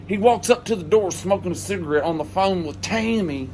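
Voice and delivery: ordinary talk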